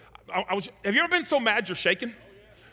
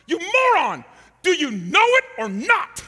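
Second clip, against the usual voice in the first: loud, high angry holler; furious exasperated yell; hollering coach; 10 semitones higher, 11 dB louder, slower than usual. Retold or imitated. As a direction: roar it down from above.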